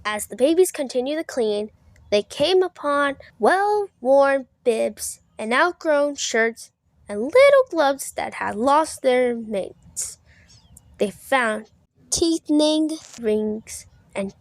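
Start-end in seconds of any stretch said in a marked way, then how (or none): none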